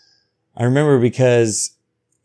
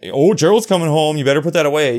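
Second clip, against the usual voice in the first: teasing warning, a touch higher and louder; taunting sibling; 5 semitones higher, 5 dB louder, faster than usual. Retold or imitated. Child taunting a sibling with a mock warning. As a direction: needle a brother with a mock threat. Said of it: "use it even as a weapon".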